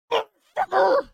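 screaming curse at the falling mushroom; furious shout. Retold or imitated. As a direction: let the whole house shake. Climax of mushroom rant.

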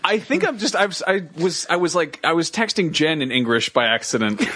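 ordinary talk

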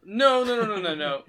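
high, loud burst of no's; emphatic correction. Real, not acted. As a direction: jump in to cut off a wrong guess. Hurriedly corrects the other's guess.

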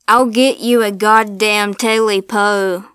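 loud furious yell; old man yelling back in rage; angry shout. Acted. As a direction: snarl it loud at the voice. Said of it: "said the old man".

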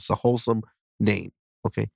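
usual speaking voice

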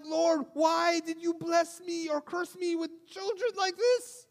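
high, loud, anguished shout to heaven; angry cry to God. Retold or imitated. Frustrated father crying out to God over his kids, high shout. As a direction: rail at God in exasperation, rising to a shout. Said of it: "I was so angry, was so hurt".

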